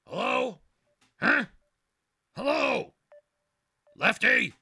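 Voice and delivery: high, loud hello into the phone; calling out. Real, not acted. Host calling out to a buddy on the phone, loud high hello. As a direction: call out to get him on the line.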